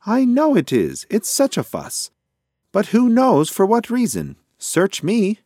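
very high, loud squawky rant; exasperated pigeon. A narrator's reading. Pigeon grumbles to mouse about the fuss, high and exasperated. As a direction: complain at the top of the bird voice.

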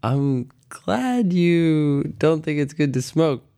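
high, drawn-out imitation of mom; mom's voice. Retelling mom's reply to a child, high slow mom voice. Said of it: "And my mom was like".

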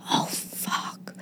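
voiceless swearing under the breath; pained whisper. Retold or imitated. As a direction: whisper it, as if sore on waking. Retelling waking with throbbing finger.

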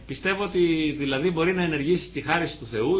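usual speaking voice

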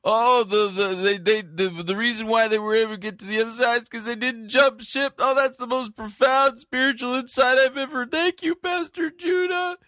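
high, excited mock fan voice; gushing fan. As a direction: ham up a starstruck churchgoer. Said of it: "There are people out there going".

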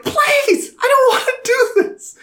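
very high, whiny pleading voice; mock pleading. Film character begging not to torture, high whiny mock voice. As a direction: beg in a pathetic squeak.

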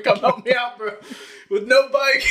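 high, loud frantic plea for help; panicked plea. Retold or imitated. Calling dad for help, high frantic plea. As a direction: beg your dad to come get you, high and loud. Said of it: "some dude's following me".

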